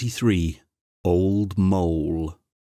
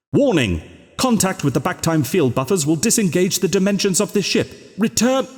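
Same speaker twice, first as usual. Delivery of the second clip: much higher and louder, faster at the end; urgent official warning; 11 semitones higher, 4 dB louder than usual. A narrator's reading. Control voice warning the Doctor off the vector, urgent and loud. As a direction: push the alarm harder each sentence. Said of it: "The box opened its lid wide".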